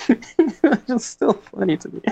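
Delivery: said through real giggles; can't stop laughing at the book, slow and high. Real, not acted.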